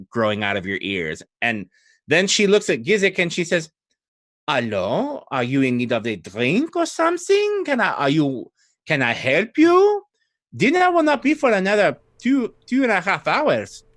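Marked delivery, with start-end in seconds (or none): 4.5-13.8 put-on high, lilting French-accented abbey cook. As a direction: play her with a heavy French accent, pitched up. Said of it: "French in this case would be Old Adari".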